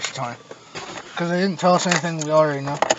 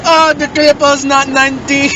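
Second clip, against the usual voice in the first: high, slow, mocking laugh-voice, louder; 10 semitones higher, 6 dB louder than usual. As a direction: jeer at him through a laugh. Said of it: "he starts laughing".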